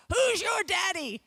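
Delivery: a father's mock-challenging yell; playful dad shout. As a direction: call it out like a dad roughhousing with his boys. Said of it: "And Alan said".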